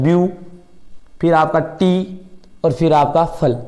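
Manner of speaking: "ब्यू", "टी", "फल" one piece at a time, slowly; टुकड़ों में उच्चारण. Shown as a demonstration. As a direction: break the word into "view", "टी" and "फल", pausing after each. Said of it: "एक बार में इतना बोला जाता है". ("ब्यू", "टी", "फल" को अलग-अलग, रुक-रुककर बोलें)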